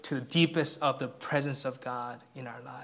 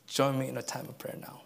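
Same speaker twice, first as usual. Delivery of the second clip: ordinary delivery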